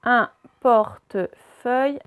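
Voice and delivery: "Un portefeuille" slowly split, Un, porte, feuille; syllable by syllable. Shown as a demonstration. Teacher reading words aloud to pupils, syllable by syllable. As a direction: sound out "Un portefeuille" in separate slow pieces. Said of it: "on va faire les deux d'un coup".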